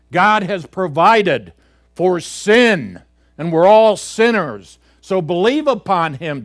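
loud, high, urgent pulpit exhortation; preaching at full force. Acted. Preacher urging young people to trust God, loud and fervent. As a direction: thunder it out to the young congregation.

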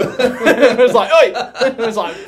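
high, loud laughing retelling; real laughter while retelling. Real, not acted. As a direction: laugh through every word.

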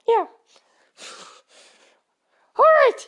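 normal talking voice

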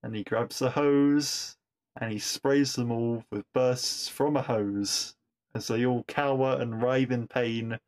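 normal talking voice

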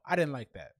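ordinary talk

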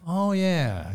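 ordinary talk

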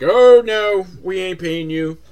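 loud high brush-off, then dropping off; mock defiant refusal. Tom Arnold refusing to pay the babysitter, loud and mocking. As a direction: slam the door with a hammed-up no. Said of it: "slams the door on her like".

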